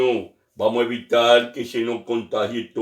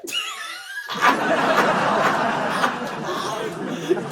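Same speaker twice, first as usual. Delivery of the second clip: long high laugh at his own joke; big laugh. Real, not acted.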